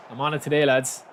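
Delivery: normal talking voice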